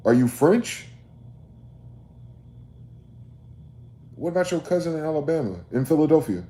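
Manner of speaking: yelling the question at the listener in disbelief; angry shout. Real, not acted. Heated rant, rhetorical outburst.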